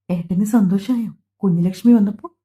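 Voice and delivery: ordinary delivery, nothing marked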